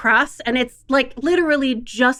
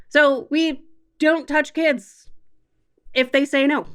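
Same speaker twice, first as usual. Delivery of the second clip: normal talking voice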